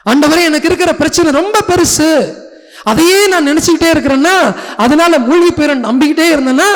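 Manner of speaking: high, loud, earnest outpouring to God; anxious plea. Acted. Acted anxious prayer amid the storm. (கடவுளிடம் உரத்த குரலில் பதற்றமான முறையீடு)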